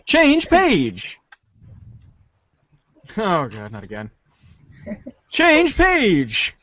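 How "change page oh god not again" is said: ordinary talk, nothing marked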